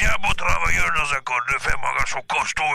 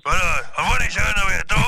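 put-on over an old rotary phone; 7 semitones higher, 5 dB louder than usual. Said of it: "Elle a bien pris l'accent".

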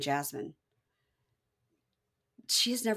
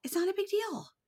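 much higher, lighter reassurance; high-pitched repeat; 13 semitones higher than usual. Real, not acted. Host brushing off redecorating, high-pitched. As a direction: lift it up high, making it small.

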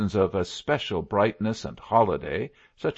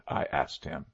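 plain reading voice

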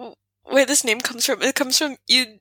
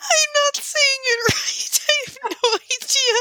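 laughing cry. Real, not acted. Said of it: "laughing at own failure".

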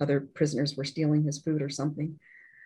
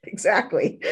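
a laugh spilling into yeah, higher and louder; laughing agreement; 7 semitones higher, 4 dB louder, slower than usual. Real, not acted.

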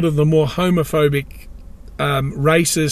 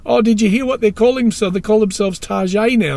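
high, quick imitated mother; imitated mother's chatter, higher; aging mother; 6 semitones higher than usual. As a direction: mimic an older mum sharing news, pitched up. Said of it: "my aging mother says".

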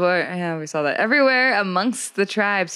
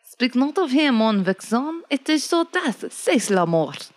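put-on higher, French-accented warning; 5 semitones higher than usual. Frenchman warns not to speak of it, French accent. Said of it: "The French say".

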